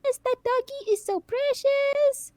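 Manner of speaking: put-on high, slow, gushing sweet old lady voice. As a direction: coo it like a sweet old lady at a car window. Old lady gushing from car window.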